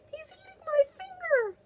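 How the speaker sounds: put-on very high, squealing little-girl cry; crying Penelope. Teacher voicing Penelope crying out about her finger, high squeal. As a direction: squeal it like a panicked dinosaur girl. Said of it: "cried Penelope".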